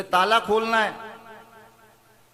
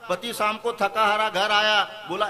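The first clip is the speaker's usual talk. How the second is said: normal talking voice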